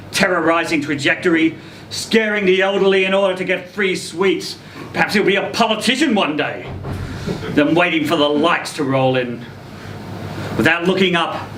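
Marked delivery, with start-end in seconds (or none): none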